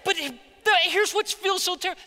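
normal talking voice

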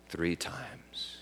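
unvoiced whisper. Real, not acted. Preacher whispering Jesus' prophecy to Peter. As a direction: breathe the words out.

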